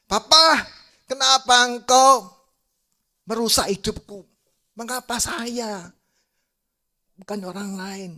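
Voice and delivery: high, anguished cry of accusation, easing in loudness; dramatized wounded rage; acted angry outburst. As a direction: confront the father, words drawn out. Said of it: "Anak ini marah".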